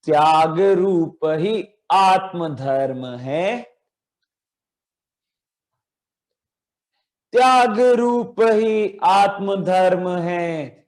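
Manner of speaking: slow, drawn-out chanted recitation of a poem line. Shown as a demonstration. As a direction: recite it slowly for children to echo. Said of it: "एक साथ बोलेंगे". (धीमा, खिंचा हुआ पाठ)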